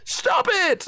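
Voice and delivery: very high, squealed protest; hammed-up high yell of refusal; mock outraged cry. Streamer answering people calling it a slur, mock shriek. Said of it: "And I'm like".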